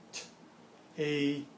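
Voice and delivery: "T-, A-S-T-E" sound by sound with gaps; isolated sounds of tasty, "T-, A-S-T-E". Shown as a demonstration. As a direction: segment "T-, A-S-T-E" into single sounds. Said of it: "a little bit faster".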